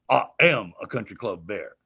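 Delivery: the Bear announces himself, weight on "am"; Bear's voice. Shown as a demonstration. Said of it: "his vocal inflection in the Bear's voice".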